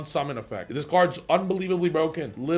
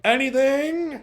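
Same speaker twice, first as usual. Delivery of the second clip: loud, higher challenging "Anything?"; very loudly; 10 semitones higher, 5 dB louder, slower than usual. Shown as a demonstration. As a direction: call it out loud across the table. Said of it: "we're gonna say very loudly".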